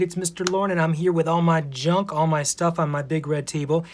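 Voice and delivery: ordinary delivery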